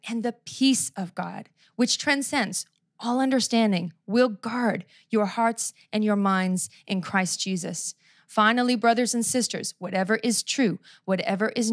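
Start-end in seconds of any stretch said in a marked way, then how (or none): none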